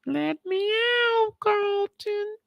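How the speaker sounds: very high slow falsetto; drugs calling. Acted. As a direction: plead from the drawer in a high voice.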